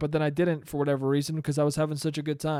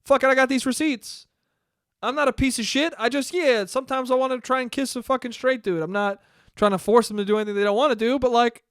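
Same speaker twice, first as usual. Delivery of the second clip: a higher, louder, brash and carefree voice; James Charles impression; 6 semitones higher, 4 dB louder than usual. James Charles backed into a corner, brushing it off defiantly. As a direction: shrug it all off, cocky and defiant. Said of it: "he was like".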